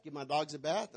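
normal talking voice

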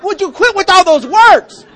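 high, loud, slow exasperated cry; loud, high, slow, fed-up cry; exasperated shout. Acted. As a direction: throw up your hands and shout it.

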